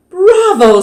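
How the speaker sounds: high, bright cheer; bravo. A narrator's reading. Teacher praising her class, high excited bravo. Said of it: "said that, their teacher".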